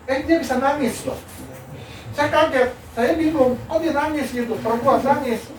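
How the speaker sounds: high, loud bewildered recounting; shocked retelling. Retold or imitated. Lecturer telling how a student suddenly cried, bewildered. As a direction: retell it in high-pitched disbelief.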